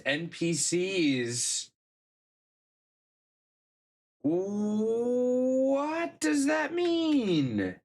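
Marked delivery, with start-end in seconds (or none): 4.2-7.8 high, drawn-out confused question; baffled. Real, not acted. Streamer reads a confusing bug report, drawn-out and baffled. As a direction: stretch it out in disbelief, much higher.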